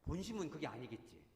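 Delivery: ordinary delivery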